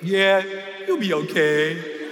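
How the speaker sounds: low, slow soothing instructor voice; easy does it, reassuring; calm reassurance. Retold or imitated. Instructor calming the nervous first-time jumper, low and slow.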